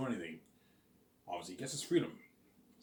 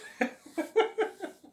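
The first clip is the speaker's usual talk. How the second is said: high squeaky laugh; 7 dB louder than usual. Real, not acted. Laughing after his impression.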